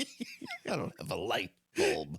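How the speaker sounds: slow, cheesy TV cop line; David Caruso. Retold or imitated. Repeating the light bulb gag to a friend, slow cop impression. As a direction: draw it out as a mock detective punchline. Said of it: "That's the cheesy David Caruso".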